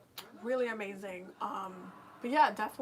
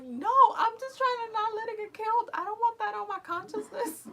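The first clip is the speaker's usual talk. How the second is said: much higher, louder exasperated protest; exasperated yell; 12 semitones higher, 9 dB louder than usual. Retold or imitated. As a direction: shout it back at the onlookers. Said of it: "I'm like".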